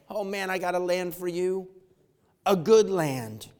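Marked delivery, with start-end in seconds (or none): none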